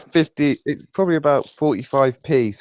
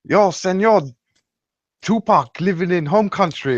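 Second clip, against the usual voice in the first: badly faked Cuban accent, slightly raised; a failed stab at a Cuban accent; 6 semitones higher than usual. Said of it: "I can't do a Cuban accent".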